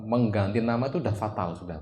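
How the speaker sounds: ordinary talk, nothing marked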